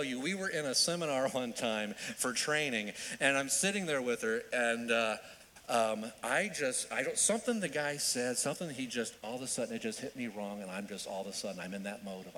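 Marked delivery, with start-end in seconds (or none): none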